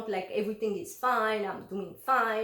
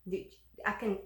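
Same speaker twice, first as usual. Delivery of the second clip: usual speaking voice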